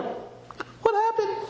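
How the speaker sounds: high, drawn-out mother's question; alarmed mother. Retold or imitated. Mother seeing her son's bloody nose, imitated alarm.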